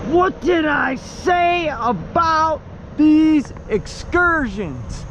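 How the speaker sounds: slow, drawn-out gloating; told you so. Real, not acted. Repeats boast more slowly.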